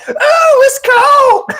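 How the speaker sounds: mock squeal, very high voice; high-pitched squeal. Young uncle sticking his foot in cold water, high squeal. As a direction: shriek it high like a boy dipping a toe.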